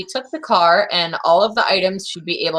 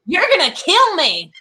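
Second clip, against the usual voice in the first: high hammed-up accusation; exaggerated alarmed cry; mock panic; 9 semitones higher than usual. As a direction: play hysterical fear, voice swooping up. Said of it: "is Eileen going".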